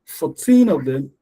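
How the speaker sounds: put-on "Fourteen" with a loud, drawn-out TEEN; stress on TEEN. Shown as a demonstration. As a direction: lean slowly on the end of "Fourteen". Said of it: "the teen is louder".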